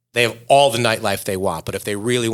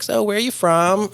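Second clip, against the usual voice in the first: put-on high, light shop-girl question; shop girl asking; 8 semitones higher than usual. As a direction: ask brightly like a store clerk. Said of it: "the girl was like".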